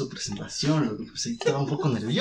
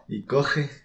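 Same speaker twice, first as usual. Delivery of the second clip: normal talking voice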